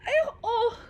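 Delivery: high, overwhelmed cries with laughter; real happy overwhelm, close to tears; emotional. Real, not acted. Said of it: "I'm, like, getting really emotional".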